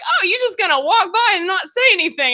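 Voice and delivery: high, loud call-out; mock affronted challenge. Host imitating Rucky calling her out, loud mock challenge. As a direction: imitate a friend loudly calling you out. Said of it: "Rucky was like".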